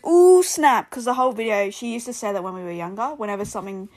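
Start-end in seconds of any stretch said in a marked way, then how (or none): 0.1-0.9 high, loud "ooh, snap"; loud mock reaction; imitated exclamation. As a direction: mimic a hyped-up catchphrase. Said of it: "She goes".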